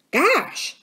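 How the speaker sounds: high-pitched exclamation of wonder; surprised. A narrator's reading. Jack spotting the island through field glasses, surprised. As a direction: let out a short gasp of amazement in the word. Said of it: "said Jack in surprise".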